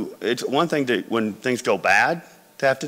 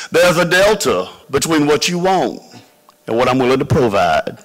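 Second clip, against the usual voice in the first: put-on louder, higher, amused voice of a tough old-school dealmaker; Lucius; 4 semitones higher, 6 dB louder than usual. Shrewd investor lectures a young founder, loud and amused. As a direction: play the shrewd investor savoring his own hard bargain, louder and higher. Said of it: "he looks over at Crom and says".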